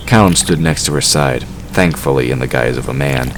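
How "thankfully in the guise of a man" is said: usual speaking voice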